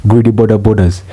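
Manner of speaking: normal talking voice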